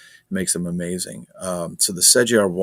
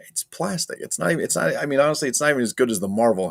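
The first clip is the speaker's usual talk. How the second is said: indignant; 6 semitones higher, faster than usual. Real, not acted. Indignant at price.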